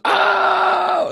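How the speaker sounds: long, high held "aah"; imitated crowd yell. Fan imitating the whole place at the knockout, high sustained yell. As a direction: mimic a whole bar crying out at once. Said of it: "the whole place goes".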